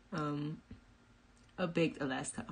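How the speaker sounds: normal talking voice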